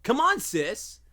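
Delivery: very high, loud pumped-up shout; hype coach voice. Shown as a demonstration. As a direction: hype up the listener like a gym bro. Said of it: "performs the app voice".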